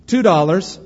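auctioneer asking. Acted. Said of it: "he cried".

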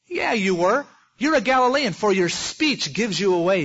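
high and loud mock accusation; loud accusing woman. Servant woman shouting at Peter in the courtyard, accusing. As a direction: push him, you know he's lying.

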